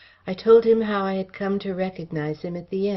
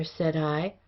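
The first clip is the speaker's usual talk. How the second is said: ordinary delivery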